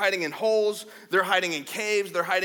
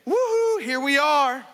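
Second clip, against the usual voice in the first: high, louder playful call of Woohoo; mock woohoo; 7 semitones higher, 5 dB louder than usual. Jonathan calling up to the Philistines, high and loud. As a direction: shout it out to the enemy, cheeky. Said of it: "We're gonna say".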